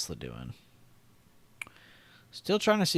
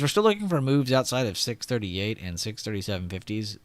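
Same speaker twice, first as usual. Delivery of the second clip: usual speaking voice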